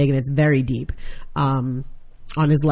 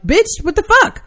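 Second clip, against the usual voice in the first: mock outrage; 13 semitones higher than usual.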